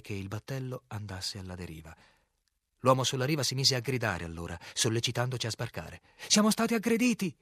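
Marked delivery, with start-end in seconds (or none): 6.3-7.3 high, loud shouted alarm; director's yell. A narrator's reading. Director to the man on the bank, loud yell. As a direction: yell the news out to the shore. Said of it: "urlò il direttore".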